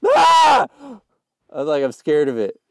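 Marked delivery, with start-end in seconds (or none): 0.1-0.7 high, very loud scream. Real, not acted. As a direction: let out another startled-sounding scream. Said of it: "Stop screaming!".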